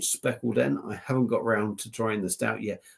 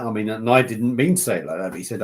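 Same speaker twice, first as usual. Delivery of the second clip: normal talking voice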